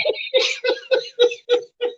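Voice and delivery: squealing burst of laughter; high laugh. Real, not acted. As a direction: cackle at his own joke. Laughs at own insult.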